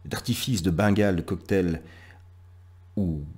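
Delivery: declaimed poem, pauses between lines, lists that build and fall back; lecture de poème. Acted. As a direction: recite with a strong attack on each new image, then settle. (poème déclamé, scandé, avec de longs silences)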